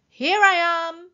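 high, loud sing-out; calling out. A narrator's reading. Hidden voice teases the searching elephants, calling out. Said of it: "it called".

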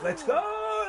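very high celebratory whoop; soaring excited cheer; hyped cheer. Real, not acted. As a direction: whoop it out for a supporter. Cheering for a supporter.

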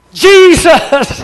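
sudden high, loud cry of the name; crying out Jesus. Retold or imitated. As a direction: shout the name in alarm as the dog lunges. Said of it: "Both of us cried out".

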